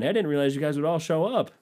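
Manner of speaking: high, mock-innocent airline agent; chirpy fake apology, pitched high. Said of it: "It's like the airline".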